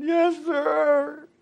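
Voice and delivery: put-on high, loud little boy's answer. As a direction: answer like a scared kid. Boy answers voiced high.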